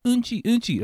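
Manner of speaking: put-on high, loud little-kid plea; young son. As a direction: imitate a small child urgently needing the toilet. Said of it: "And he's like".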